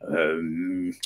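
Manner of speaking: lightly voiced pondering troll; troll giving in. A narrator's reading. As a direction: hum in thought, then loud agreement. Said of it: "said the troll".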